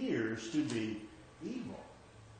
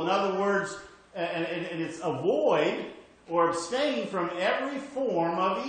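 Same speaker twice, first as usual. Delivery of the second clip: higher and louder, driving each phrase home; preaching at full force; 4 semitones higher, 5 dB louder than usual. Acted. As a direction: preach it like a call to the congregation. Preaching the verse with rising force.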